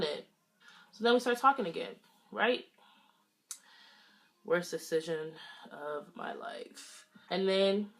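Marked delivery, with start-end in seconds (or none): 4.4-7.1 slow whispered aside, almost to herself; regretful whisper. Real, not acted. Narrator whispering a rueful aside to viewers. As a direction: breathe it out like a confession you regret. Aside about a bad choice.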